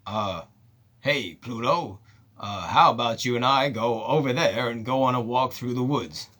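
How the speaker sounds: low, quiet, ordinary voice, no effort; a flat-out celebrity playing Mickey as himself; mock Chris Pine as Mickey. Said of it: "How are you gonna play him, Chris?".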